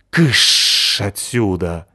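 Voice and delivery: slow, drawn-out, loud; shooing cat. Acted. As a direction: shoo the dog away. Кот прогоняет пса. (кошачье шипящее «кыш»)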